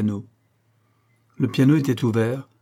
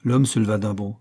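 ordinary delivery, nothing marked

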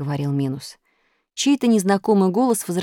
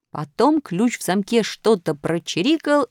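ordinary delivery

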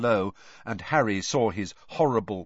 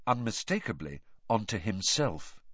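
plain reading voice

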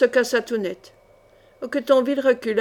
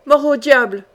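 louder, angry curse; growled oath; 3 semitones higher, 6 dB louder than usual. A narrator's reading. Guard inside curses at the intruders, growled oath. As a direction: swear loudly through the door. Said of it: "gronda la voix".

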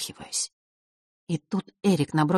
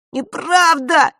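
sobbing cry; 12 semitones higher, 8 dB louder, much slower than usual. A narrator's reading. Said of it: "вскричал Эрик, плача навзрыд".

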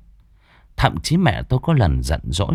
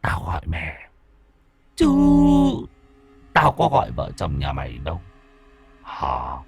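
unhurried cold whisper; 3 semitones lower than usual. A narrator's reading. The spirit answers the grandfather in a low cold whisper. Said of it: "thủng thẳng".

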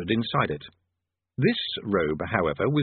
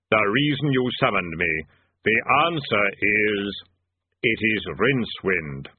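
grim reaper voice; slower than usual. A narrator's reading. Death speaks.

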